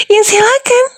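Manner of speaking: giggly mocking invitation; laughing taunt. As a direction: dare him while laughing. Taunting him to act.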